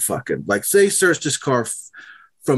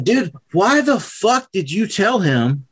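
exasperated; 7 semitones higher than usual. Retold or imitated. Asking a friend why he told the cop, exasperated. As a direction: demand an explanation from a friend, pitched up. Retold scolding of confessing friend.

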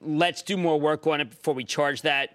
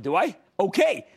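ordinary talk, nothing marked

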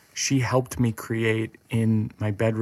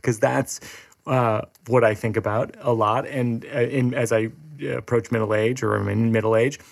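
ordinary delivery

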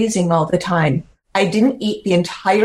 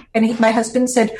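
ordinary talk

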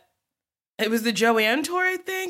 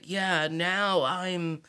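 slow, much softer, lower mock butch voice; mock deep man; 4 semitones lower, 7 dB quieter, much slower than usual. Queen after pulling off her wig, mock deep and slow, much softer. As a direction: drop it low like a drag queen unmasked. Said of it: "pull your wig off and be like".

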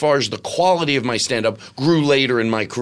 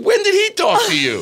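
shocked; 4 dB louder than usual. Retold or imitated. Shocked reaction to Patti.

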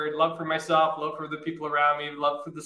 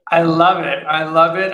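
excited; 10 dB louder than usual. Real, not acted. Loving her friend's news.

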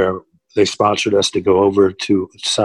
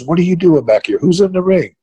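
sharp, much higher, louder demand; angry boss demanding; 9 semitones higher, 3 dB louder than usual. Retold or imitated. Agent catching a wrestler backstage, angry higher demand. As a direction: confront him like a furious agent. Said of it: "He goes".

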